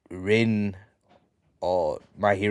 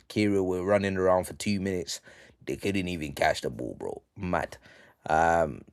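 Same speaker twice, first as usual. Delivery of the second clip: normal talking voice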